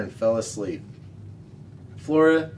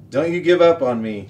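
louder, urgent call to the team; shouted; 5 semitones higher, 8 dB louder than usual. A narrator's reading. Flora calling back to her exhausted sled dogs, shouting. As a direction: drive the tired dogs on with a shout. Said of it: "she shouted to the dogs".

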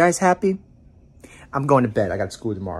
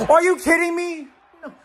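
outraged disbelief; 11 semitones higher than usual. Real, not acted. Outraged at game result.

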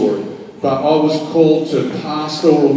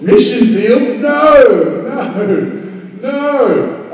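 a high shocked what, then a run of no's; shocked protest; 8 semitones higher, much slower than usual. Retold or imitated. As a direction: squeal in alarm at the news. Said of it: "They said".

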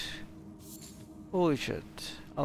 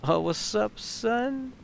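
playful dude greeting; 11 semitones higher, 4 dB louder, slower than usual. Pretend. Greeting NPC in put-on voice.

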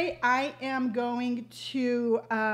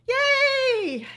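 high loud relieved yay; relieved cheer; 14 semitones higher, 7 dB louder than usual. Real, not acted. After a hard memory, a relieved high loud cheer. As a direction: cheer at getting through it. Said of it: "All right, I got through it".

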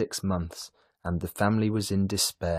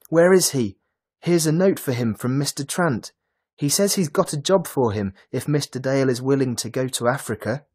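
higher, louder breathless news; excited; 6 semitones higher, 7 dB louder than usual. A narrator's reading. Lily bringing Trant's note to Mrs Dale, excited. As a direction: rush the good news out. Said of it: "Lily cried excitedly".

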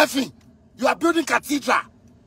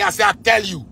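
ordinary talk

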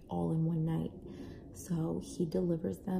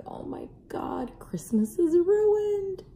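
a slow, hammed-up wail that climbs much higher and louder; mock wail; 13 semitones higher, 7 dB louder, slower than usual. Mother retelling her panic to viewers, hammed-up slow wail. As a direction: play the ruined-Christmas despair big and drawn out. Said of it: "on the verge of tears because I'm like".

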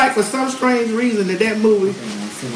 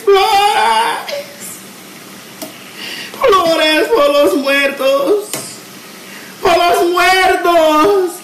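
imitated screaming; 8 semitones higher, much slower than usual. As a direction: holler it like the lady in the movie. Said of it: "screaming this".

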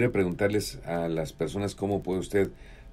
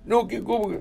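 much higher, startled squeak; high imitation of the old man protesting; Amaro Mata's voice; 13 semitones higher than usual. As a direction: pitch way up in mock surprise. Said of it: "Y me empezó a hablar como él".

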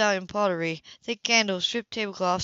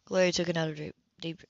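usual speaking voice

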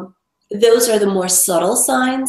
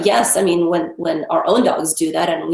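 usual speaking voice

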